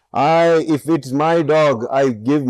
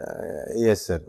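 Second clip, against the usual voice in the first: softer, halting reply of a cornered employee; hesitant admission; 4 semitones lower, 7 dB quieter than usual. Retold or imitated. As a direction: admit it reluctantly, quietly. Cornered employee answers boss.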